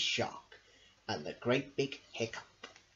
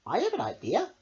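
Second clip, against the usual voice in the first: octopus voice; 9 semitones higher, 3 dB louder, faster than usual. Acted. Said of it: "said Octopus".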